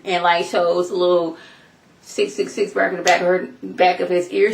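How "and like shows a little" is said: usual speaking voice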